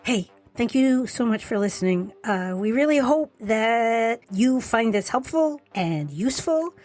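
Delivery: charming, lilting sign-off to listeners; animated outro read; podcast outro. Real, not acted. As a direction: sweetly ask for a review, drawing out key words. Reads the show's outro.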